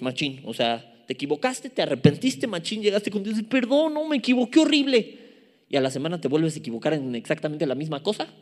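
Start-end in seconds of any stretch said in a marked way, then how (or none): 3.4-5.2 higher-pitched imitation of a penitent; mock contrite plea. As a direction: act out begging God for forgiveness. Acts out repentant sinner begging God.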